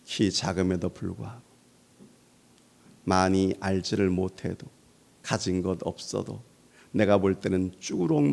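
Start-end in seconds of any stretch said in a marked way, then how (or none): none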